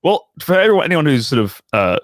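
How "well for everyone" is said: normal talking voice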